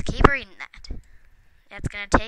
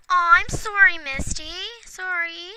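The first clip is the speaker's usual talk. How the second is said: sorry to cat; 8 semitones higher than usual. Real, not acted. Apologising to her pet cat.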